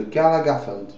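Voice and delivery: put-on name said with an English accent. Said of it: "avec l'accent anglais, je le dis". (prononcé avec l'accent anglais)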